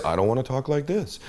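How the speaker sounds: softly, well under normal level; low volume. Shown as a demonstration. Coach demonstrating a mismatched volume, quiet. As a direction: drop down to a quiet voice. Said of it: "If they talk at a certain volume".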